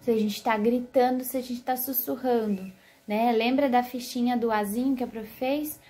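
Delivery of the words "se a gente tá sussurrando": ordinary talk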